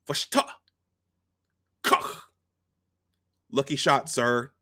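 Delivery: snarky gunner. Retold or imitated. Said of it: "he got a little snarky".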